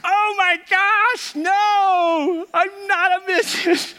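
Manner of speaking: put-on very high, slow whiny little girl protest; drawn-out high childish complaint; imitating a little girl. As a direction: play the indignant seven-year-old. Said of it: "I hear Maya go".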